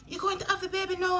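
very high, quick mimicked question; high-pitched mock-startled mom; imitating mom. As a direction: play mom's reaction, high and rushed. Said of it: "she look and she said".

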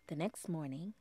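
ordinary delivery

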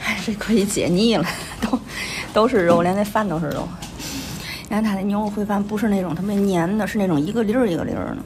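ordinary delivery

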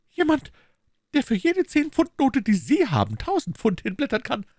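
very high, fast, aggrieved maid; indignant maid. Acted. Maid complaining to her mistress, very high and fast. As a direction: rattle off the complaint, put upon.

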